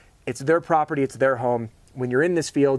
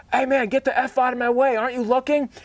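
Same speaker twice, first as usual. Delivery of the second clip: screaming; 11 semitones higher than usual. Retold or imitated. Canoe man yelling at the oncoming boat, high mock shout. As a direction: shout him off like an angry paddler. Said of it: "he starts screaming".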